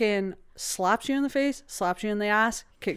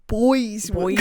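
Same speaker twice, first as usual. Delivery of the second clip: put-on Boston-y accent. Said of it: "kind of a Boston-y thing".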